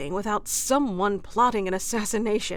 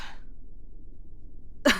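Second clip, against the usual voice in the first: light breathy laugh; an amused little laugh; soft chuckle; 4 dB quieter than usual. Acted. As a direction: chuckle at the double meaning. Teasing after innuendo.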